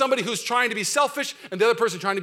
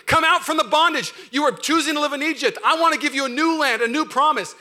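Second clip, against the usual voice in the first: scream; 7 semitones higher, 5 dB louder than usual. Acted. Preacher voicing the Lord calling people from bondage, loud shout. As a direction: cry it out like God calling his people free. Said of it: "exclamation point, scream".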